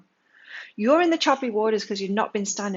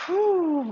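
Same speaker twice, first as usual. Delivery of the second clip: whew of relief; 10 semitones higher, 4 dB louder than usual. Retold or imitated. Relief after last-minute rescue.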